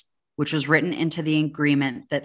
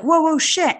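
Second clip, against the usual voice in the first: high, loud mock panic cry; startled alarm; 12 semitones higher, 5 dB louder than usual. Bystanders yelling as a car swerves at them, in high, loud mock alarm. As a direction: yelp it as the car swerves at you. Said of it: "Everybody's like".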